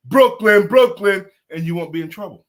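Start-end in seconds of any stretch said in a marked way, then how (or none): 0.1-1.3 loud party shout; loud cheer. Acted. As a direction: shout it with arms up. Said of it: "throw both arms up and go".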